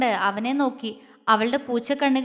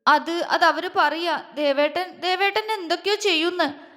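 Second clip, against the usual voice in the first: tearful; 4 semitones higher than usual. A narrator's reading. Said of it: "ആ കണ്ണുകൾ അപ്പോഴും തോരുന്നില്ല".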